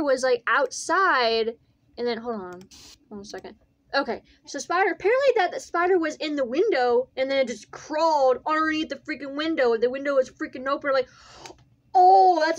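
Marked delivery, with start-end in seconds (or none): none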